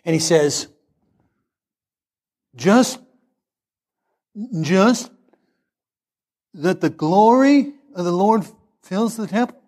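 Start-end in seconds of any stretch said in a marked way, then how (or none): 2.5-9.6 a hint of a halting slow speaker; pause, stumble, then get it out; slow halting student. Shy front-row student answering the professor, lightly halting. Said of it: "doesn't quite talk right".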